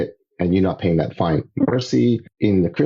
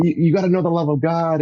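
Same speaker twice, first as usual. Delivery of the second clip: high, insistent plea; eager pleading; 10 semitones higher than usual. Retold or imitated. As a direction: keep pressing him, voice up high. Pleading with friend again.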